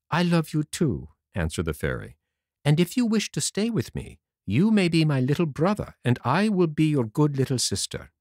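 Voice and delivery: a hint of a kind fairy sister; gentle fairy voice. A narrator's reading. The fairy answers Pinocchio in a lightly voiced fairy tone.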